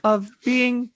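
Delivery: put-on prim British accent, royal and proper; British Deku. Deku playing the Queen of England, British accent. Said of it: "Deku, but British".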